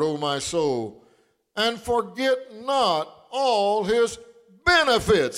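slow, drawn-out psalm lines rising high; slow scripture reading. Acted. Pastor reading the Psalm to his church, slow and swelling. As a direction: let the verse swell slowly.

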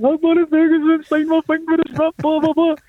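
mock crying kid. Boy wailing to the stewardess about his ripped autograph, mock wailing. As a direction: wail it like a heartbroken nine-year-old. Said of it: "I'm greeting".

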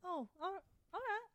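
ordinary delivery

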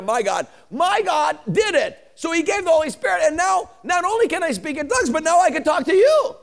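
high, loud, building to a shout; triumphant testimony. Retold or imitated. Preacher voices the mute man now able to talk, triumphant. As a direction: shout the retold miracle in triumph.

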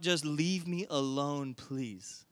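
worn-down parent, dropping soft and low; tapping out. Acted. Parent giving in to nagging children, wearily. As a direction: give up slowly, trailing to a near-inaudible plea. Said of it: "It is purely tapping out".

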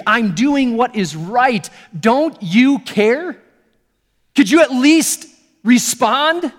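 pleading loudly with God, fed up and hurt; frustrated pleading complaint. Retold or imitated.

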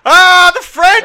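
hammed-up terrified Orson Welles, drawn out. Imitating Orson Welles reading the champagne ad, terrified yelp. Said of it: "the way he reads it is like".

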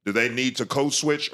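ordinary delivery

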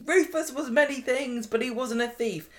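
high-pitched mock self-righteousness; hammed-up moral superiority; high and mighty. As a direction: lecture him, nose in the air, pitched high. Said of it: "gets all high and mighty".

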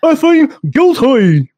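hammed-up Southern accent, very high and loud; over-the-top bad Southern accent; Keanu Reeves. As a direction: belt it out in an awful, stretched Southern drawl. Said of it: "That was Keanu doing a Southern accent".

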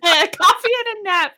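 amused laughing echo. Real, not acted. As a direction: savor the punchline through laughter. Echoing the joke while laughing.

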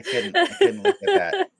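laughing, high and loud. Real, not acted. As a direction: crack up while saying it. Laughing at a silly photo.

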